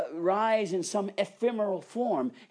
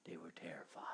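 breathy dramatic whisper; whispered, ominous; hushed whisper; 11 semitones lower, 20 dB quieter than usual. Acted. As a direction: let it fall to a whisper of dread.

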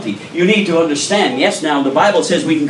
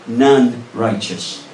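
slow emphasis; 2 semitones lower, much slower than usual. Real, not acted.

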